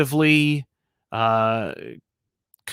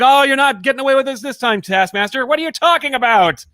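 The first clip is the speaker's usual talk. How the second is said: mock hero taunt; 10 semitones higher, 6 dB louder than usual. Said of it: "People are like".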